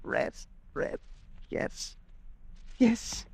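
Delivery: drained, whispered relief; whispered exhaustion. Acted. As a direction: sink back spent and murmur. Exhausted after his fit.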